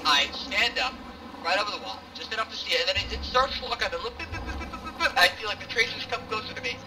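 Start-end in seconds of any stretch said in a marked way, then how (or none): 4.3-5.1 high, quick repeated blips mimicking a targeting sound; imitated targeting blips. Telling squad how the turret found him, high imitated blips. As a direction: tick it out like a machine locking on.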